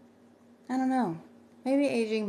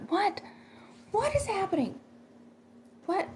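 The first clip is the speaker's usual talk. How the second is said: startled; 13 semitones higher than usual. Real, not acted. Reacting to a bump on stream.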